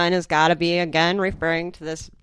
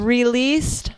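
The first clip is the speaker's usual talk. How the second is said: higher, questioning echo; pointed disbelief; 6 semitones higher than usual. Real, not acted. Co-host repeating news of the release, rising in disbelief.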